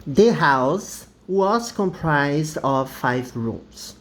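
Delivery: slow, careful English reading; teacher modeling an example sentence. Shown as a demonstration. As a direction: read it slowly so students follow. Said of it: "O verbo to be no passado".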